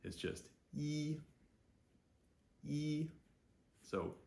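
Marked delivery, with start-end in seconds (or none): 0.8-3.1 E as "ee", high and level; letter-name E. Shown as a demonstration. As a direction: hold E on one high, steady note. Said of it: "the name of the letter E".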